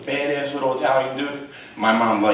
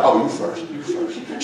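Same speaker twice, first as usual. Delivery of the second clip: faux polite; slower than usual. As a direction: sweetly wave her ahead, hiding the food stamps. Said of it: "So I, I would go".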